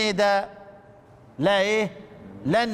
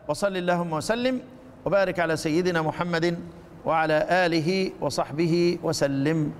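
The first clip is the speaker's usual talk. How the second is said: lower, formal closing blessing; closing prayer; 5 semitones lower than usual. Acted. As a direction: bless the Prophet solemnly and evenly. (دعاء ختام بصوت خاشع منخفض)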